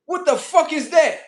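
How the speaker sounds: high, loud cat complaint; indignant cat. Retold or imitated. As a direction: voice a cat protesting the noise. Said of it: "they be like".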